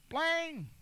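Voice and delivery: high, sharp "Blang!" like a flashing chain; high comic bling sound; mock bling sound. Mocking rapper's flashy chain.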